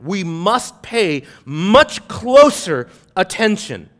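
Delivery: slow, weighted "much closer"; stress on MUCH CLOSER. Shown as a demonstration. Preacher reading a warning to congregation, "much closer" stressed. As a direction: lean hard on "much closer", drawing it out. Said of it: "we put emphasis on the word".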